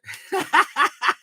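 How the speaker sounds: high burst of laughter. Real, not acted. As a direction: laugh out after the chant. Laughs after own chant.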